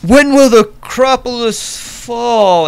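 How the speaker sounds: high, loud, slow lament; plaintive prophet. Acted. The prophet cries out for the light's return, plaintive. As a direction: ask it longingly of the heavens.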